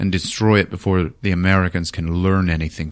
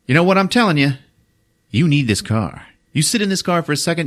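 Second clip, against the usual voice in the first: higher-pitched insistent sales push; smooth-talking salesman; 8 semitones higher, faster than usual. Retold or imitated. Salesman urging a customer into the car, pushy smooth talk. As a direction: press the buyer like a car salesman. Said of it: "smooth-talking salesman".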